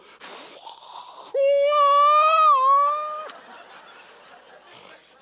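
long, high, loud cry after a blown breath; mock pent-up scream; strained release noise. Shown as a demonstration. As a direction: blow out air, then let out a sustained wail. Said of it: "you have to go".

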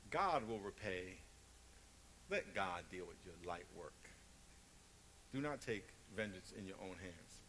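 quiet comforting pulpit tone; low calm assurance. Real, not acted. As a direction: settle them gently. Preacher drops to a calm close.

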